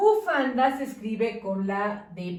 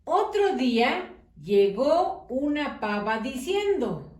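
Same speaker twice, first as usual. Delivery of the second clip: put-on slow storytelling for children, lightly lilting; reading to children. A narrator's reading. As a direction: read slowly to little ones, with pauses. (maestra leyendo un cuento a niños, con calma)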